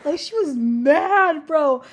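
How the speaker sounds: slow, loud emphasis; emphatic. Real, not acted. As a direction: lean hard on mad. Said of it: "emphatic retelling".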